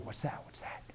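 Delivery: barely audible, curious whisper; very quiet, secretive questioning; hushed whisper. Acted. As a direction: whisper as if straining to hear. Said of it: "you're gonna get quiet".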